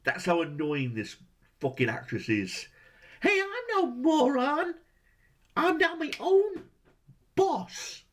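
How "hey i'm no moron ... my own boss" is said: high mocking nasal-free falsetto impression of Vera. Critic mocking the annoying actress, high mock voice. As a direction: squeak it out much higher, mocking her.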